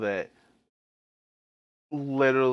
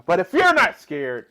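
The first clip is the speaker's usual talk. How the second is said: louder; 5 semitones higher, 9 dB louder than usual. Real, not acted. Repeats the phrase louder then softer.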